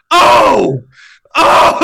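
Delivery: high, loud shouted "oh"; re-enacted shout. New dad retelling the screaming birth, re-enacted shout.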